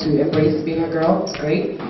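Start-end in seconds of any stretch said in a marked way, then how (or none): none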